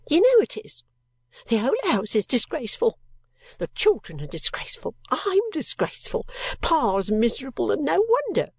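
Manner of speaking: upset outburst that keeps spiking up in pitch; near tears, bursting out. A narrator's reading. Ink-stained girl pouring out her shame to Esther, near tears. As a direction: pour it out like a girl about to cry. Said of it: "the poor girl was near crying".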